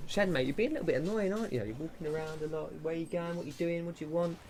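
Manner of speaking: high, airy coo at the pet; pet talk. Real, not acted. Streamer teases his pet Shad wandering around, high cooing pet voice.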